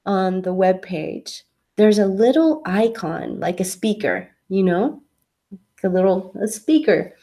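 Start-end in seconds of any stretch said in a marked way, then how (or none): none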